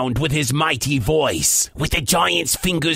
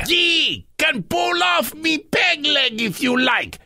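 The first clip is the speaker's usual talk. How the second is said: higher pirate voice, wheedling; pleading pirate; 9 semitones higher than usual. Acted. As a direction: bargain with the giant as a pirate. Pete bargains with giant.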